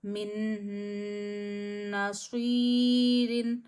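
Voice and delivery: मिन नसीरिन with the nasal नून stretched, very slow; ghunna recitation. Shown as a demonstration. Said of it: "तो गुन्ना करेंगे". (मिन नसीरिन में गुन्ना करना)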